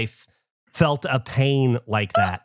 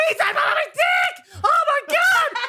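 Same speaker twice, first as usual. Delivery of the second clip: high-pitched reenacted scream; shouting high over laughter; retold yell. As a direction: yell it in pain and panic. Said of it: "I go".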